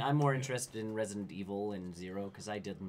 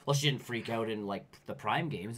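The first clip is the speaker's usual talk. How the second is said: ordinary talk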